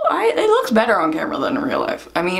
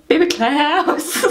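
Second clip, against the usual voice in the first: fond yell; 6 semitones higher, much slower than usual. Shown as a demonstration. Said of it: "I always yell".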